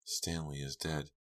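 slow, soft murmur; hushed, slowed statement of a death. A narrator's reading. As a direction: murmur it as the fact sinks in. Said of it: "Linda Morrison murmured".

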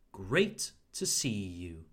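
"to" cut to a short "t"; reduced to. Shown as a demonstration. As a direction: say "to" as a quick "t" sound. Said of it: "To becomes more like t".

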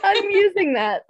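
loud, high and laughing; real laughter breaking into the words; delighted laughing. Real, not acted. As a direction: claim the joke while cracking up. Delighted by the quoted line.

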